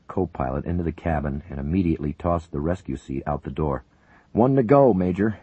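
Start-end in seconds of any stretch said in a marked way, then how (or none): 4.3-5.4 high, loud report; shouted report. A narrator's reading. Paramedic reporting progress over the noise, loud. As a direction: call it up to the major. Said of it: "Lincoln reported".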